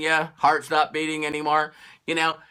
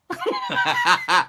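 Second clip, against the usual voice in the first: very high laugh; squealing laugh. Real, not acted. Mocking the search for all the facts, a high laugh.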